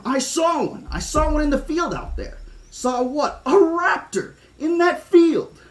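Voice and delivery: put-on high excited kid yelling about a raptor. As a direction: blurt it out breathless with alarm. Tim reports the raptor.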